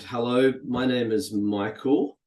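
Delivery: slow, spaced-out dictation of a script line; reading typed ad copy aloud, word by word; dictating while typing. Shown as a demonstration. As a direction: read the words out slowly as you type them. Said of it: "I'm just typing at the moment".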